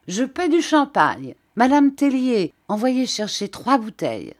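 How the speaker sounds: loud, high, expansive call; exclaimed. A narrator's reading. A client exclaims to Madame, ordering champagne loudly. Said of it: "s'écria".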